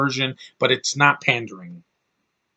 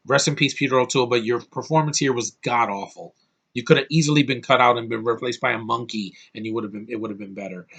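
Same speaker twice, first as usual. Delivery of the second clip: ordinary talk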